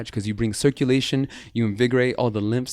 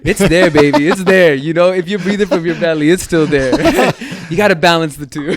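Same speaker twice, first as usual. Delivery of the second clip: high, loud, playful insistence; real amused, boisterous comeback; laughing protest; 6 semitones higher, 11 dB louder than usual. Real, not acted. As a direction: call it out over your own laughter. Laughing protest at host's teasing.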